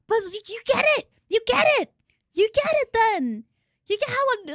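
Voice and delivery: loud, high, emphatic excitement; thrilled. Real, not acted. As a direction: exclaim it, overjoyed to be understood. Thrilled someone shares the condition.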